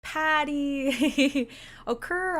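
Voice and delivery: laughing through the name. Real, not acted. Streamer laughs while greeting a subscriber. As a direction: giggle out the viewer's name.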